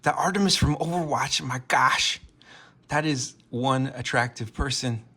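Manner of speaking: loud, high starstruck gushing, easing at the end; excited fan. Retold or imitated. Fan first seeing Artemis in Fortnite, excited. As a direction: gush over a celebrity sighting. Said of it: "I got excited when I first saw Artemis".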